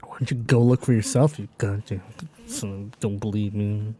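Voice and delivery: low, soft grumble trailing into muttering; trance mumbling. Acted. Tranced player grumbling at his doubting companion, mumbling. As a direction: mutter resentfully under your breath. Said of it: "Can I mumble while I trance?".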